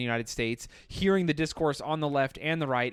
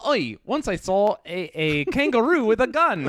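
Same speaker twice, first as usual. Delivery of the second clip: Australian; 6 semitones higher, 4 dB louder than usual. Retold or imitated. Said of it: "They're like".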